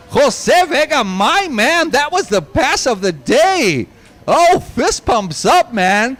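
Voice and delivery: high, thrilled live commentary; excited race call. Real, not acted. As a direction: erupt at a stunning last-lap overtake. Thrilled live call of winning pass.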